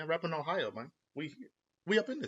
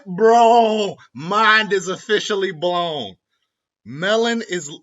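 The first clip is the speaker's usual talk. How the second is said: much higher and louder, shouting in amazement; mind-blown excitement; 5 semitones higher, 9 dB louder, much slower than usual. Real, not acted. Vlogger realizing lemon and melon share letters, shouting in amazement. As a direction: yell out the discovery like it changes everything. Still amazed after laughing.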